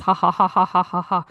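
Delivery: steady, repeated mock ha-has; forced laughter. Shown as a demonstration. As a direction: demonstrate fake laughing on one even note. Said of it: "laughing about how funny this is".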